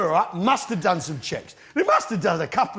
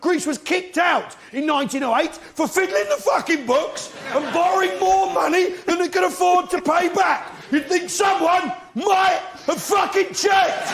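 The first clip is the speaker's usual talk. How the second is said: worked up; 7 semitones higher than usual. Acted. Comedian ranting at the audience about Greek debt, worked up. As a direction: build into an exasperated rant. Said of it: "Al's all worked up".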